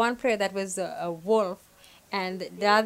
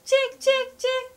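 high, loud sing-song call of chick, chick, chick; wolf coaxing the chicks with high calls; 5 dB louder than usual. Shown as a demonstration. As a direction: lure them in with a bright high call. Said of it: "wolf role in demo".

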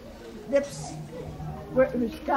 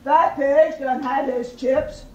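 loud, forceful wolf voice; hungry wolf; 8 dB louder than usual. A narrator's reading. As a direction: call out like a wolf eager for his meal. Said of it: "licked his lips and said".

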